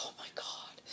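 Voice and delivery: hushed, breathy oh my God; stunned whisper; whispered disbelief. Retold or imitated. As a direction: whisper it in shock.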